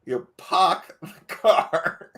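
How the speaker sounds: very slow Boston "park" said as pock, no r; mock Boston accent. Host imitates the guest's Massachusetts accent, very slow. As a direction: mimic a Massachusetts "park the car". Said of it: "What you're saying is P O C K".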